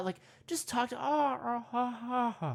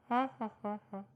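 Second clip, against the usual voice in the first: monkey laugh. Pretend. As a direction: hoot like a monkey.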